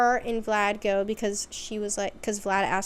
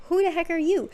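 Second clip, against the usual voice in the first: higher, louder startled demand; mock startled challenge; 7 semitones higher, 6 dB louder, faster than usual. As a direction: act out confronting a stranger. Heroine challenges a stranger in retelling.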